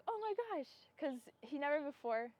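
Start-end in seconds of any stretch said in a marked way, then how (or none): 0.1-0.7 high-pitched retold "oh my gosh" moment; awed, much higher voice; amazed reaction. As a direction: relive the astonishment, pitch jumping up. Retelling her amazed reaction.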